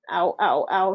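mild pained groaning. Acted. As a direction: wince through a stomachache. Green banana hurts stomach.

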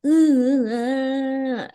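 high, drawn-out mock moan of pleasure. Host mimicking the woman in the love scene, mock moaning. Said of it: "the whole time this woman is like".

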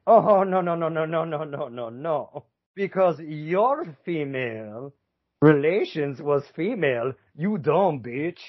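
high, hammed-up France rebuffing a claim; sassy put-on dismissal; mock indignant refusal. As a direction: play an outraged objector, drawing words out. Voicing France refusing England's claim.

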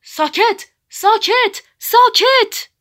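rising, very high, very loud screech; shrieking. A narrator's reading. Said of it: "یک دفعه جیغ کشید".

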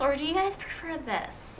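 put-on alternative voice. Shown as a demonstration. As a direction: switch to a high, soft podcast voice. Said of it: "should I talk in my normal voice?".

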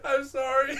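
high, apologizing through laughter; helpless laughter. Real, not acted. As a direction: squeeze out words while cracking up. Cracking up at the accent.

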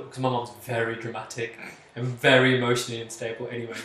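usual speaking voice

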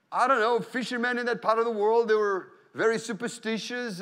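ordinary talk

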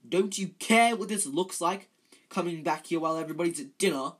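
loud, indignant telling-off; scolding. A narrator's reading. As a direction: scold them like an outraged prefect. Percy scolds the three in the story.

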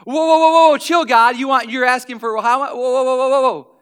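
loud, high frantic protest; mock panic. Stingy fry owner begging God to stop, loud and frantic. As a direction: flap and protest over the fries.

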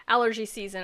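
ordinary delivery

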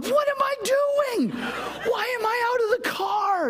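high, slow cry of dismay; panicked self-questioning. Retold or imitated. Pastor alone by his car, high cry of dismay. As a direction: squeal at yourself in alarm. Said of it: "standing outside of my car going".

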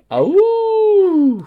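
high loud sung howl; wolf howl. Acted. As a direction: throw back and howl like a wolf. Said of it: "who sang awoo".

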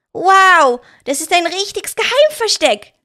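put-on high, loud child's gasp of wonder; a little boy, wide-eyed and thrilled; amazed boy. A narrator's reading. Leon marvels at the secret hiding place, high amazed child's voice. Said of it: "staunte Leon".

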